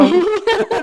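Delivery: sudden high burst of laughter. Real, not acted. Expert laughing about the witch face. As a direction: laugh out at the joke.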